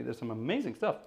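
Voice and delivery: usual speaking voice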